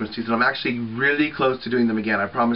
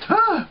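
sudden very high shriek of alarm; mock shriek; 5 dB louder than usual. Imitating older relatives shrieking at her, very high shriek. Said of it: "they're like".

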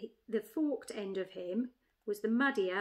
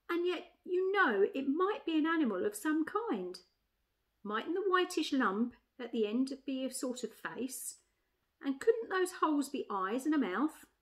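higher-pitched musing elephant lady; pondering she-elephant; 4 semitones higher than usual. Acted. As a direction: reason it out tentatively as the elephant. She-elephant reasons aloud.

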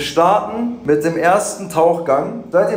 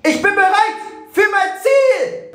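high, slow heroic declaration; epic hero voice; 4 dB louder, slower than usual. Pretend. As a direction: announce it like an anime hero. Dramatic pre-dive battle cry.

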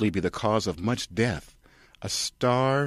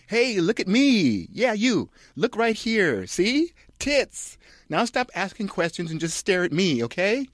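flaunt it, ditzy and very high; Kirsten voice; 12 semitones higher than usual. Acted. Kirsten Dunst showing off to the audience, high ditzy voice.

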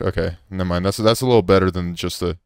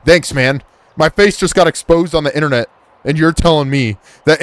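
loud, higher-pitched sarcastic retort; sarcastic indignation; 6 semitones higher, 5 dB louder than usual. Pretend. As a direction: throw mock thanks back at the officer. Sarcastic retort to policeman's warning.

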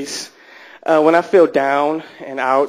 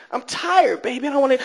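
high-pitched, whiny refusal; mock tired whine; 9 semitones higher than usual. Man woken for ice cream by partner, mock tired whine. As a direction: beg off in a raised, put-on whine.

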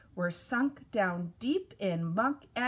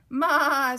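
loud, wavering maa; goat bleat; 3 semitones higher, 8 dB louder than usual. A narrator's reading. Goat greets the truck, loud bleat. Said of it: "said a goat".

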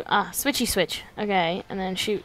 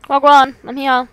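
louder, higher hammed-up wagwan; loud slang greeting; 5 semitones higher, 9 dB louder than usual. Real, not acted. Player hails the game world, a loud playful greeting. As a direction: announce your arrival with swagger.

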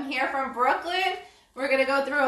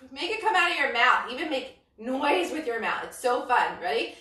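ordinary talk

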